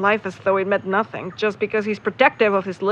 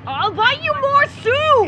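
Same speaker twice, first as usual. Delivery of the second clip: exasperated shout; 13 semitones higher, slower than usual. Acted. Heated soup quarrel.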